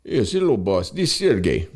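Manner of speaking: hammed-up impression of Sergey calling in to his boss; imitating Sergey. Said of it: "he's like".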